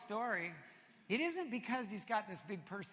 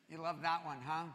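ordinary delivery

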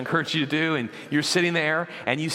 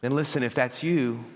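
usual speaking voice

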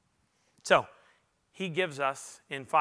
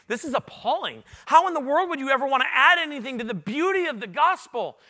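higher, louder, exasperated disbelief; indignant outburst; 8 semitones higher, 6 dB louder than usual. Retold or imitated. Pastor reacting to the scripture with indignant disbelief. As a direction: throw up your hands at the text. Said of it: "I read this and I say".

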